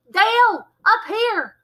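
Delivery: high, loud urgent shout; urgent call. Acted. Girl calls Dale up to fight, shouting. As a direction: call him up, fast.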